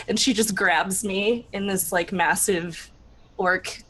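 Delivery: normal talking voice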